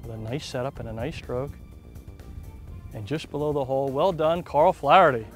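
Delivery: low, hushed golf call; golf commentator. Acted. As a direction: murmur the putt like TV golf. Live golf play-by-play.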